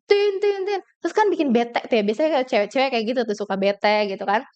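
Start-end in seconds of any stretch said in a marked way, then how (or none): 0.1-0.8 high, sharp mock horn honks; imitated truck horn. Speaker imitating a truck honking on the way to a seminar. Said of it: "ada truk yang".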